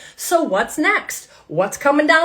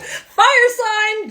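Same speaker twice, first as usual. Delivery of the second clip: higher, burst-out fire sign; excited exclamation; 9 semitones higher, 3 dB louder than usual. Real, not acted. Reader laughing at herself, excited high exclamation.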